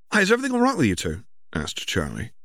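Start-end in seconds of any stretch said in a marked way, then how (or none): 0.1-1.2 higher, fast cheerful check-in; breezy big brother voice; cheery older brother. Acted. Older brother asks siblings if all is well, cheerful and quick.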